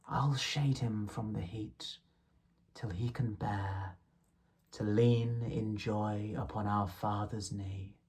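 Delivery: softly whispered promise; hushed. Acted. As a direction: draw the listener in close. Soft passage, partly whispered.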